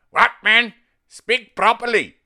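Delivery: loud, high mock-Indian scolding; exaggerated Indian accent. Uncle correcting a relative's speech, in loud Indian accent. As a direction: shout it in a hammed-up Indian accent. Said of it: "the Peter Sellers Birdie Num Num accent".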